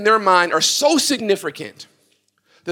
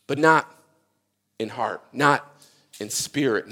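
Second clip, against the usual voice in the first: ordinary talk, nothing marked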